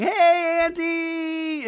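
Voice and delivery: smiley greeting. Retold or imitated. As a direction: chirp a cheery hi to your aunt. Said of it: "she's just always smiley".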